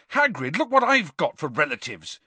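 put-on a touch of boyish fury, understated; mildly angry insistence; furious. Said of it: "Harry said furiously".

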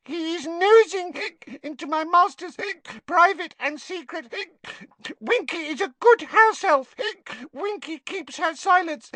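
high, tipsy house elf; a wobbly, squeaky little elf. A narrator's reading. Drunk Winky ranting.

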